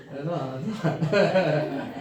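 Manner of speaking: slow, laughing through the words. Real, not acted. Joking to the crowd about his looks, laughing. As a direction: chuckle it out, slowly.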